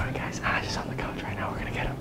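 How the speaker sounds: very soft, hushed whisper to camera; conspiratorial whisper. Real, not acted. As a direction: whisper it so the target can't hear. Sneaking up to prank someone.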